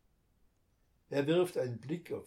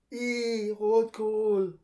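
loud, high yuck at the red cabbage; disgusted cry; 10 semitones higher, 11 dB louder, much slower than usual. A narrator's reading. Boy cries out in disgust at the goose dinner plan. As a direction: squeal out your disgust. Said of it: "ruft Lukas".

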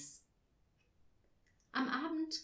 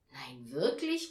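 slow, louder, rising question; surprised exclamation; 6 semitones lower, 5 dB louder, slower than usual. A narrator's reading. Papa hearing Tina has a present, calling out surprised. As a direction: draw it out in mock amazement. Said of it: "rief Papa".

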